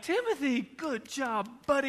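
high-pitched parental cheering; delighted praise. Retold or imitated. Dad congratulating little Timothy on finished peas, delighted praise. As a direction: praise a toddler in a high, lifted voice.

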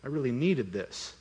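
quiet, understated choked-up thanks; retold holding back tears. Preacher retelling a grateful man's reply, lightly imitated tearfulness. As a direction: softly imitate a man who is moved. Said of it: "He's fighting back tears".

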